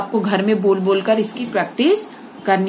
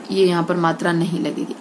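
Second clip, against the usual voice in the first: normal talking voice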